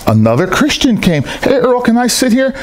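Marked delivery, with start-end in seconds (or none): 1.2-2.5 imitating a high-pitched cheerful greeting; mock-friendly higher voice, butting in; chirpy interrupter.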